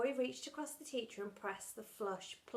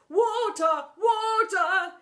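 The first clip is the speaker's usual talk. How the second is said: high, loud pleading call; desperate cry; 10 semitones higher, 14 dB louder, much slower than usual. Acted. Teacher running to bathroom, desperate cry. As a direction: cry out for water while running. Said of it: "he cried as he hurried".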